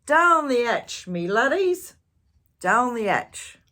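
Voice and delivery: booming pirate toast; pirate yell. Acted. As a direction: bellow it pounding the table.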